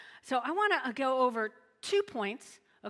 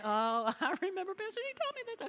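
imitating grateful listener; 7 semitones higher, 3 dB quieter than usual. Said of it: "you'll say".

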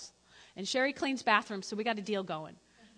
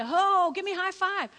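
high, louder breezy greeting; playful God voice; 7 semitones higher, 4 dB louder than usual. Retold or imitated. God passing by humanity, asking for a high five. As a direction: cheerily ask for a high five. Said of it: "who's checking out humanity".

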